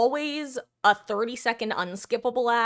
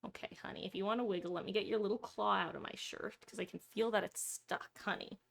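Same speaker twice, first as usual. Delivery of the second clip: soothing murmur; 10 dB quieter than usual. Real, not acted. Soothing pet on her shirt.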